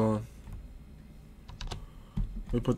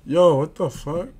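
shocked; 6 semitones higher, 7 dB louder than usual. Real, not acted. Suddenly taken out in game.

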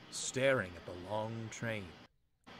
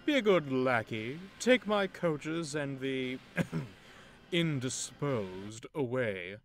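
louder, slow, lordly orders; haughty command; 3 semitones higher, 6 dB louder, slower than usual. Acted. Big engine ordering Thomas to take his coaches, haughty. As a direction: talk down to a lackey. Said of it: "the big engine beckoned to Thomas".

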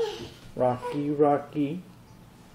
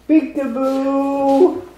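high, loud, drawn-out singsong; playful peekaboo; 10 semitones higher, 6 dB louder, much slower than usual. Real, not acted. Playing peekaboo with small child.

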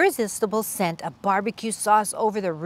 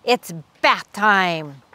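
slow, louder action-hero line; tough-guy taunt; 3 semitones higher, 4 dB louder, slower than usual. Acted. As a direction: deliver it like a movie hero.

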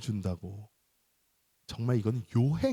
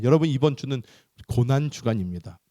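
ordinary delivery

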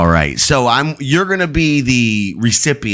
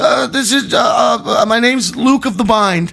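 much higher, nervous prank caller; panicked caller voice; 11 semitones higher than usual. Acted. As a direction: play a frantic caller. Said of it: "I'm supposed to be in a panicked voice".

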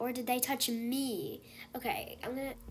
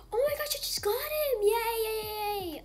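high, loud gleeful yays; real joy, much higher and louder; thrilled cheering; 11 semitones higher, 6 dB louder than usual. Real, not acted. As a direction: cheer the win with delight. Celebrating a catch.

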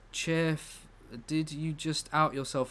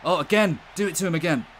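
excited cheering; 6 semitones higher, 4 dB louder than usual. Real, not acted. Cheering a dribble live.

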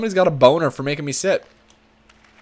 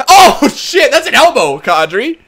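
loud, high exclamations over the hit; excited outburst; 13 semitones higher, 10 dB louder than usual. Real, not acted. Gamer reacting to an elbow on Kadri, excited shouting. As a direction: yell it in mock outrage.

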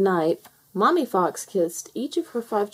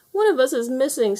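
mildly tearful, higher voice; a touch of tears, pitched up; sniffly; 7 semitones higher than usual. A narrator's reading. Tufty telling Mother Hen a fox is missing, sniffly. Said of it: "sniffed Tufty".

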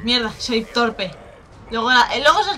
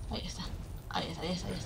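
low hushed murmur; quiet; 6 semitones lower, 16 dB quieter, much faster than usual. Real, not acted. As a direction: murmur it under your breath. Spots enemy quietly.

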